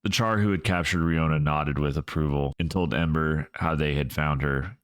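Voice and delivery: plain reading voice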